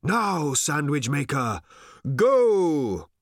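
high call, loud on the last word; urgent command. A narrator's reading. Old man ordering sandwich maker onto beast, urgent call. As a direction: send him off, building to a loud GO.

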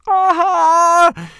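high, drawn-out yelled exclamation; loud cry of dismay. A narrator's reading. Boy sees dead mouse on the pillow, high cry.

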